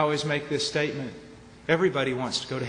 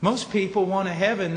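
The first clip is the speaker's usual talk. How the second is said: ordinary delivery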